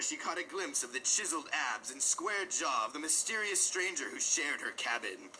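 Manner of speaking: ordinary delivery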